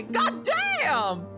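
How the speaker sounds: usual speaking voice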